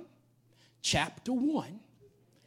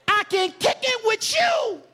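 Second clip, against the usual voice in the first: preaching; 3 semitones higher, 5 dB louder than usual. Acted. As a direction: lift the room with it. Preaching at full force.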